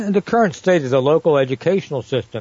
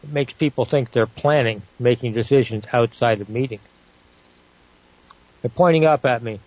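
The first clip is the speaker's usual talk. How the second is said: ordinary talk, nothing marked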